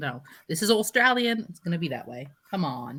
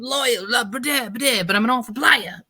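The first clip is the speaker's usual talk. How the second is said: higher-pitched, hammed-up mateship guy; mock player impression; 6 semitones higher than usual. Podcaster mocking a Survivor player's tribe-loyalty speech, hammed-up impression. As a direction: send up his blah-blah loyalty speech. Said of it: "he's just like".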